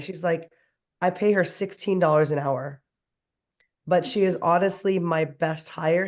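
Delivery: ordinary talk, nothing marked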